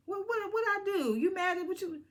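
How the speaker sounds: high-pitched imitation of a baffled friend; mock innocent protest. Heavenly going over to Sweet Tea, high mock-innocent protest.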